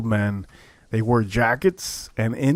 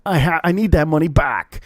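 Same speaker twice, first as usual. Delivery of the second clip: mild mock scream, pitched up; retold screaming; 5 semitones higher than usual. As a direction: act out a desperate demand without full volume. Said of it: "he starts screaming".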